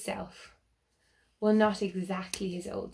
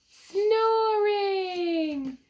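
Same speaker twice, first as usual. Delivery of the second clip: much higher, loud, long call; triumphant reveal of the answer; excited answer; 11 semitones higher, 5 dB louder than usual. Real, not acted. Quizzing the children, calling out the answer with glee. Answers her own riddle.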